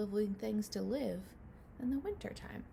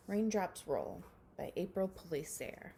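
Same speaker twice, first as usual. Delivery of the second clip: ordinary delivery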